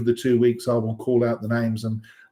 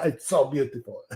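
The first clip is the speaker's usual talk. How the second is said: mock French accent, playfully done; a hint of hammed-up French; 4 dB quieter than usual. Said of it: "It's very French there".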